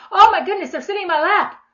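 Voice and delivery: high, loud delighted exclamation; thrilled father. Acted. Father thrilled his children climb into his lap, delighted. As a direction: gush with delight, acting a doting father. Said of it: "God is so thrilled".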